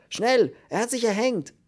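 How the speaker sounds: high, urgent cry; gasped alarm. A narrator's reading. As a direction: raise the alarm in panic. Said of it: "keuchte er". (aufgeregt, in Panik gekeucht)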